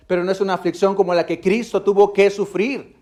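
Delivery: loud, high, ringing pulpit voice; fervent preaching. Acted. The preacher exhorting the church, fervent and loud. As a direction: lift it to the congregation.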